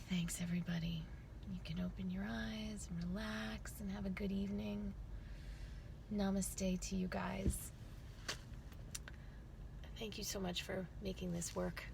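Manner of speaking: soft whisper to close the meditation; hushed namaste whisper; whispered farewell. Acted. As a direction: breathe the goodbye.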